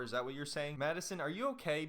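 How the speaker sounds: usual speaking voice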